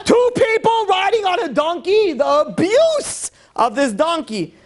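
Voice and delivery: high, slow mock shriek; hammed-up horror, every word stretched out; outraged protester. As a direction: shout it like a scandalized activist. Acted animal-rights protester, comic shout.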